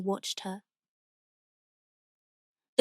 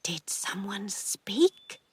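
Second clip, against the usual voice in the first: soft, slow, hushed question; softer hushed question from a frightened aunt; whispering; 6 dB quieter, slower than usual. A narrator's reading. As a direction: ask it softly and slowly. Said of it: "her Aunt Pauline whisper the words".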